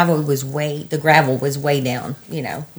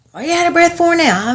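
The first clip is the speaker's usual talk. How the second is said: high mock voice of an irritated husband; aggravated husband; 12 semitones higher, faster than usual. Retelling husband's aggravated phone demand, mock high voice. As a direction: imitate his aggravated demand, pitched high. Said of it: "he was getting aggravated".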